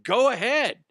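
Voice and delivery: high, loud goading challenge; retold defiant dare. Clerk standing his ground to boyfriend, loud defiant dare.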